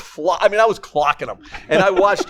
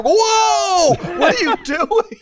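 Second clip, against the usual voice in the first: high shocked whoa; shocked moms; much slower than usual. Retold or imitated. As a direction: gasp it out high and startled. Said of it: "And they're".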